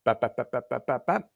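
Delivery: rapid-fire spoken bap sounds; imitated gunfire. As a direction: mimic a burst of shots with the mouth.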